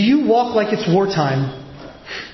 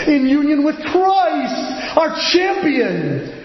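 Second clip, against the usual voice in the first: higher, ringing preacher's build on every phrase; full-force preaching; 7 semitones higher than usual. Acted. Preacher warning the congregation about the devil's schemes, full-force preaching. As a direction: preach it out, rising and insistent.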